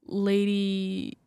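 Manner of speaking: ordinary delivery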